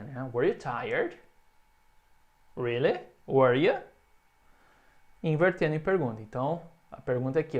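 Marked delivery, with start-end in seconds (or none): 0.3-3.8 were you tired with a small rising end; modeling rising intonation; little rise. Shown as a demonstration. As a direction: let were you climb. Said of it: "tem essa subidinha".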